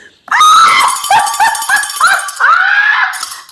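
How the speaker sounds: loud, high shriek of laughter. Real, not acted. Host shrieking with laughter at the clip. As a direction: burst into a screaming laugh. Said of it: "I about hit the floor".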